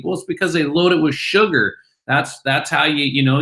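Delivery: normal talking voice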